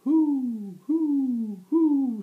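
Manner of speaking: very high, loud hooting; high owl call. A narrator's reading. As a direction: hoot like an owl. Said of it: "said the owl".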